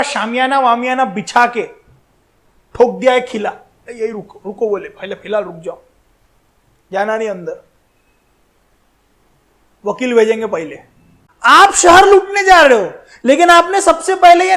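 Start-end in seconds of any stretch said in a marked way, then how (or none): 3.9-4.7 much softer, low-key retold command; hushed order to halt. Retold halting order, voiced low. 4.9-10.8 quiet, deep-pitched instruction to wait; low-key retold order.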